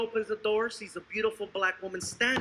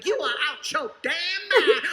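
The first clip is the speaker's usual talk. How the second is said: indignant, raised a little; sassy disbelief; 4 semitones higher, 4 dB louder than usual. Acted. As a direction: scold them like they're crazy. Woman voiced, outraged.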